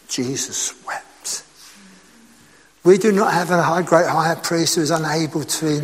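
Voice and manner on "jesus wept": hushed. Acted. Climax of the passage about death.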